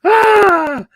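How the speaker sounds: sudden high falsetto squeal. Real, not acted. Host startled on live stream, squeals high and loud.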